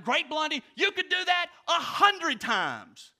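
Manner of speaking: high, loud shout from the crowd; imitated fan hollering at full voice; yelling spectator. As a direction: yell it up excitedly to a daredevil, stretching the end. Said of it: "who yelled at him".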